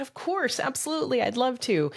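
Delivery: bright eager high yes, voice lifted well up; high, eager, delighted acceptance. Retold or imitated. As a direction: gush the yes, glad to be asked. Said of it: "And I said".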